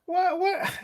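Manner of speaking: high, startled double what; squeaked what, what; surprised. Real, not acted. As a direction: caught off guard by a comment. Reacting to viewer comment.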